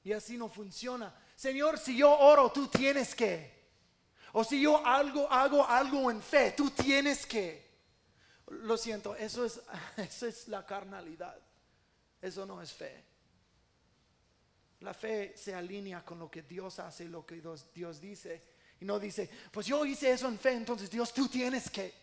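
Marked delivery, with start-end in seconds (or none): 1.3-7.7 loud, high, insistent demand on God; mock a believer giving God orders at full volume; demanding prayer. Voices a demanding, presumptuous prayer. 19.5-22.0 demanding prayer. Acted. Mocks the same demanding prayer.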